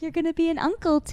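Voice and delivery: quick, high, bright quote; fast excited quote of the news. Retold or imitated. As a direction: rush it out excitedly. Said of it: "And she's like".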